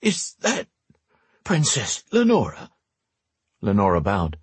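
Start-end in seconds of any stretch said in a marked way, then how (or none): 0.0-2.7 put-on high, astonished old man's voice; stunned old man, pitched high; gasped disbelief. A narrator's reading. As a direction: ask it in amazed disbelief. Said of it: "the old man gasped".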